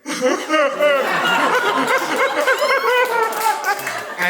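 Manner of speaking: very high, loud laughing scream; joyful shriek. Retold or imitated. Meeting his idol Clive Barker at last, shrieking laugh-scream. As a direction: let out an overwhelmed squeal of joy. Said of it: "And I went".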